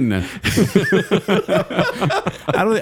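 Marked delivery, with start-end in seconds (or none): 0.1-2.8 high laugh breaking into the words. Real, not acted. Laughing at the joke.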